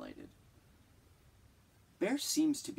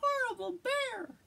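very high, squeaky bat voice; bat squeak; 4 dB louder than usual. Acted. The bat squeaking at the bear, high squeaky voice. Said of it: "The bat squeaked".

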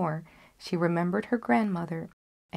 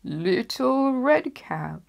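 put-on slow, higher wolf faking a girl; wolf disguised; 6 semitones higher, 4 dB louder, much slower than usual. Wolf pretending to be the girl at the door, faked. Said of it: "replied the wolf".

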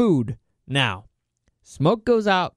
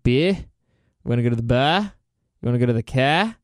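Australian accent; 4 semitones lower than usual. Shown as a demonstration. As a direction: demo Australian accent with bleating, r-less "Beer", "bar", "car". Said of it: "you have to sound like a sheep".

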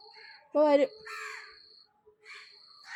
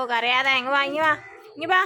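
loud, insistent call to come; calling over; 3 semitones higher, 7 dB louder than usual. Real, not acted. Owner calling crying Chikku to come, loud and insistent.

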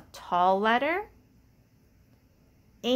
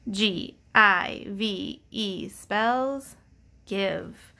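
slow spelling; much slower than usual. Shown as a demonstration. Teacher spells the word slowly for the class. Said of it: "and then that E".

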